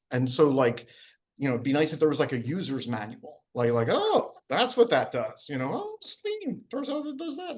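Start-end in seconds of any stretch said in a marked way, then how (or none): 3.9-5.5 higher, slow dawning oh; mock realization. Lecturer mimics a student's discovery, slow mock realization. 5.7-7.6 much higher, softer amused oh; mock discovery. Lecturer mimics a student learning about the spleen, high soft mock surprise.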